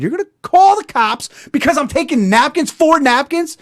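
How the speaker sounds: high, loud disbelieving yell; shouting in outrage at the store clerk; incredulous shout. Retold or imitated. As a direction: yell it back at her in disbelief.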